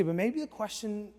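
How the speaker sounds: normal talking voice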